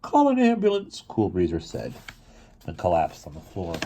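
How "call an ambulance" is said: high, desperate call for help; high urgent cry for an ambulance; urgent plea. Acted. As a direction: beg them to phone fast. Cool Breezer begs for help.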